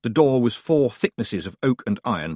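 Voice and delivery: ordinary delivery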